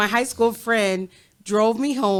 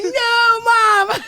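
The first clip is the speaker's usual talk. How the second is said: put-on high, wailing teenage protest; mock whiny plea; 14 semitones higher, 5 dB louder than usual. Grounded daughter pleading with mom, high mock whine.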